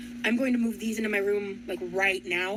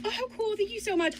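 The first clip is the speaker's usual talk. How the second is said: thanks pitched much higher, delighted; 10 semitones higher than usual. Real, not acted. Thanking viewers for the gift: high delighted gush.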